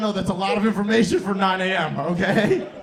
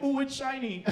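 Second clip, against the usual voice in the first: high, soft cooing; mock wonder; 6 semitones higher, 6 dB quieter than usual. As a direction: coo softly like a dazzled child. Retold dumbstruck reaction to a gun.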